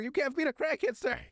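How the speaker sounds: high-pitched exasperated protest; mock pleading. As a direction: imitate pleading with an angry customer. Voices what he wanted to say.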